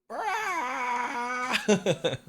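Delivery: high, breathy squealing laugh; delighted high giggle. Real, not acted. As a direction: burst out laughing at the cat. Laughing at the pet.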